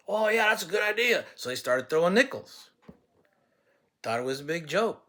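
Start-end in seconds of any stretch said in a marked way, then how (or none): 0.1-1.2 higher, louder dopey classmate voice; a hammed-up eager classmate; dumb eager classmate. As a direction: play the gullible kid agreeing eagerly.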